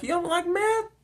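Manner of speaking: very high incredulous question; imitated disbelief. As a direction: squeak it as the surprised woman. Acting the surprised woman.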